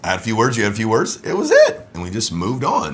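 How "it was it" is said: emphatic high end. Real, not acted. Husband insists the spat ended fast. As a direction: jump way up, louder.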